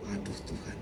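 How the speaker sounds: breathless whisper "Aduh Tuhan"; whispered plea. Retold or imitated. Preacher retelling his whispered prayer when ill. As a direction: barely breathe it out, worried.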